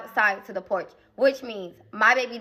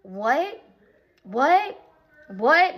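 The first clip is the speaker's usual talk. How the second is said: disbelieving what; 4 semitones higher, 3 dB louder, much slower than usual. Retold or imitated. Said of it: "my mom start like".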